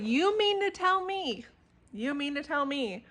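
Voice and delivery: emphatic and incredulous, challenging the absurd plot. Real, not acted. Vlogger rants to viewers about a book's plot, incredulous.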